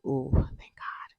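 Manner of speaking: breathy whispered sigh of relief, slowed down; relieved whisper. Retold or imitated. Student retelling her grade, whispering her relief to God. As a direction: whisper it like a private thank-you prayer.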